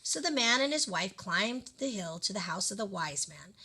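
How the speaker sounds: usual speaking voice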